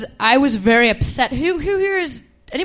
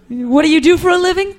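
mocking replay; 6 semitones higher, 4 dB louder than usual. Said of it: "mock re-enactment".

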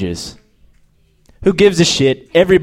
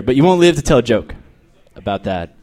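normal talking voice

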